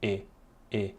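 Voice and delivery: "E" as a narrow closed French e. Shown as a demonstration. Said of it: "more wide than this".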